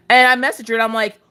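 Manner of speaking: normal talking voice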